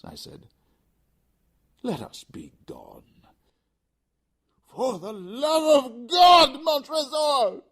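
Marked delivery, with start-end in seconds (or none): 1.8-3.0 slow cold whisper; Montresor's whispered mockery; mocking whisper. 4.7-7.6 high, loud, slow desperate shriek; Fortunato's last scream; anguished scream. Acted. Fortunato's final plea.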